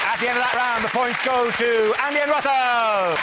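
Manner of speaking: high, building, drawn-out final names; announcer. Real, not acted. Host gives points to audience, high drawn-out announcement. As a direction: announce the winners, stretching the last name.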